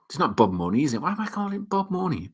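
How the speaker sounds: normal talking voice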